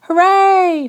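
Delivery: a high, loud hooray; Father cheering for Patrick. Acted. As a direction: cheer for your hero son. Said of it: "Father cheered".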